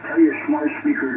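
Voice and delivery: high, punchy station ID read; radio DJ voice. Acted. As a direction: sell it like a radio promo. DJ station promo.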